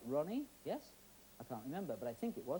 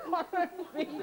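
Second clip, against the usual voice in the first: laughing; 10 semitones higher than usual. Real, not acted. Laughing at the mismatched picture.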